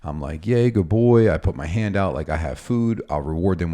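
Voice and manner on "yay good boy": higher, louder burst of delight; excited praise. Acted. Dog trainer rewarding a puppy for looking back, excited praise. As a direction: cheer for the puppy like an excited owner. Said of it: "I get really excited".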